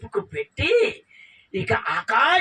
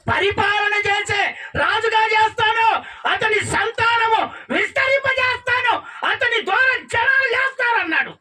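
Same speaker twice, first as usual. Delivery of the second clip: booming promise; 11 semitones higher than usual. Acted. Angel promising Hagar her son will be king, booming vow. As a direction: proclaim it like a vow.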